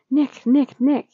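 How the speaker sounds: loud, high calls of a name; calling out. Acted. Charlie calls Nick awake in the hospital bed, loud and high.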